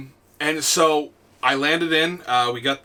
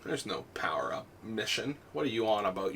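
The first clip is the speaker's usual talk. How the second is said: hushed, dismissive and lower; low, quiet retort; 6 semitones lower, 14 dB quieter than usual. Retold or imitated. Player doubts the kid's mission claim, quiet retort. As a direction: drop to a near-whisper of disbelief. His deadpan retort to kid.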